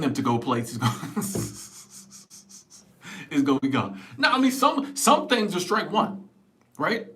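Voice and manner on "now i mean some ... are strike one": ordinary talk, nothing marked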